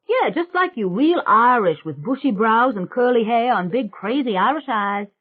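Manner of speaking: put-on loud, high, brash country girl; a loud, high swamp witch bragging to the family. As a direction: belt it out like a cocky backwoods girl. [name] teasing Michael.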